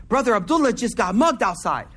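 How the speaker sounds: ordinary delivery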